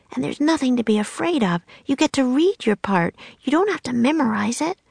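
lightly voiced girl, high and encouraging; coaxing little sister. Annie encouraging Jack to join the play, high and coaxing. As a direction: talk a nervous brother round.

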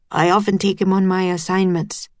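ordinary delivery

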